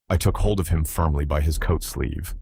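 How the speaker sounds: plain reading voice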